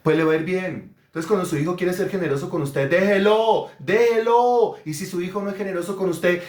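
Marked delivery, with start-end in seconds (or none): 2.9-4.7 raised, louder exhortation; emphatic urging. Real, not acted. Preacher urging parents to let children give, loud insistence. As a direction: press the command home, loud and high.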